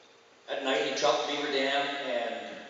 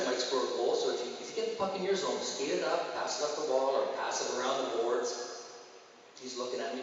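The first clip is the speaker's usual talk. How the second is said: normal talking voice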